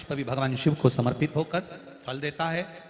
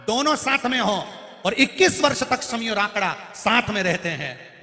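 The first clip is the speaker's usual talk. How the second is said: fervent preaching; 8 semitones higher, 7 dB louder than usual. Acted. Preaching at full force.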